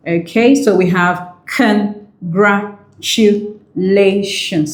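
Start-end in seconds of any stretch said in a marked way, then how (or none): 1.5-4.7 slow, separated beats on CONGRATULATIONS; syllable by syllable. Shown as a demonstration. Teacher showing the word has five syllables, syllable by syllable. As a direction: sound out CONGRATULATIONS one syllable at a time. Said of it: "that is five syllables".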